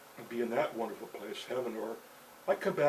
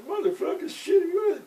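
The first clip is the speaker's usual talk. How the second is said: much higher, loud furious cursing; swearing gunman; 6 dB louder than usual. Retold or imitated. Gunman cursing his misfired gun, high and furious. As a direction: spit out the curse as the gun fails. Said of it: "then the guy's swearing".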